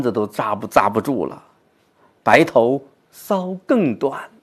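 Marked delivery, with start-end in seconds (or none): 2.2-4.3 very slow, fading recital; 缓慢低回地朗诵; poetry recitation. Shown as a demonstration. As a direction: let it sink, drawn out. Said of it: "就是自己的这个变化".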